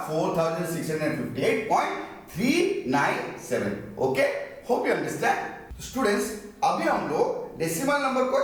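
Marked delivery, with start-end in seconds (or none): 0.1-3.8 whole number in full, then "थ्री नाइन सेवन" digit by digit; correct decimal reading. Shown as a demonstration. Teacher models it for students, "थ्री नाइन सेवन" as single digits after point. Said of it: "जैसे कि मैं यहाँ पे कहूँगा".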